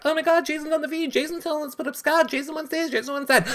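squeaky mock-panic imitation; mocking high voice. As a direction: parody the sheep following orders. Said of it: "everybody's like".

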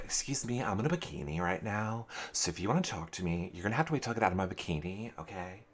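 soft, fast, fussy excuse-making; hurried, hushed imitation of an evasive housemate; dismissive brush-off. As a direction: brush someone off quietly and quickly.

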